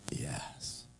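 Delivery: a dying man's whispered yes; faint whispered yes. Retold or imitated. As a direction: barely breathe the word out. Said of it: "And he just goes".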